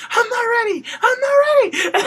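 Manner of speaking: high, loud panicked squeal; mock terrified shriek. Host imitating Eva Marie fleeing Naomi, mock terror. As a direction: shriek it as a hammed-up scared wrestler. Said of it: "legit looked scared".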